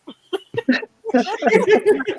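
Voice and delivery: loud high laugh; high burst of laughter. Real, not acted. Big laugh.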